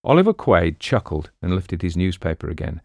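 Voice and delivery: usual speaking voice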